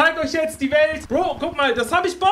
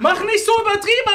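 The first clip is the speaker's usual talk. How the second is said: higher, louder, fast insistent repeat; emphatic repeat; 7 semitones higher, 4 dB louder, faster than usual. Real, not acted. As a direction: hammer the line home, fed up. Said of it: "hab ich gesagt".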